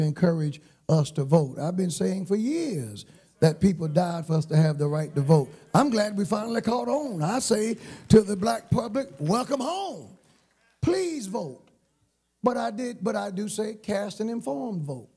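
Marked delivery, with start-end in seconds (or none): 5.7-15.1 high-pitched preacher's appeal; preaching exhortation. Real, not acted. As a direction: lift the voice and exhort the congregation. Preacher exhorts congregation to vote.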